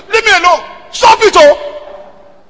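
furious drawn-out yell; angry shout. Retold or imitated. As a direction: yell at her to leave you alone. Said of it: "I was shouting at her".

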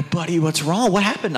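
high, urgent worried questions to his son; asking in alarm what happened; worried dad. Retold or imitated. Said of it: "And I go".

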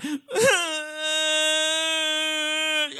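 long, high held wail; imitated child's crying. Lost little girl crying for her dad, imitated wail. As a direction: mimic a little kid's sustained cry. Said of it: "she started crying".

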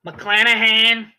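put-on half-hearted Boston accent, drawn out; attempted Boston accent. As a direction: take a bad stab at a Boston accent. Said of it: "I can't do a Boston accent".

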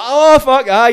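high, loud burst of recognition; excited fan reaction. Retold or imitated. Podcast host imitating a fan hearing the name, excited. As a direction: blurt it out like a starstruck fan.

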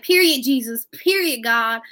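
high, loud imitation of a millennial prayer; millennial praying. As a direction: mimic a young woman praying in hype slang. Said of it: "it was a millennial praying".

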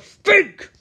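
high, loud burst; mock shouted demand. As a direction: bark it as an acted order. Acted interrogation of Abby.

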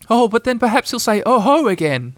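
high, worried little voice; anxious. A narrator's reading. Piglet worrying about the Heffalump's reply, anxious. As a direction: suggest it anxiously, pitched high. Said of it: "suggested Piglet anxiously".

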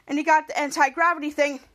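ordinary delivery, nothing marked

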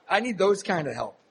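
normal talking voice

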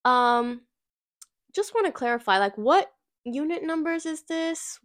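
a hint of a bright, overly polite email voice; sweet polite email voice. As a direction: play up her own polite email tone. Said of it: "I was like".

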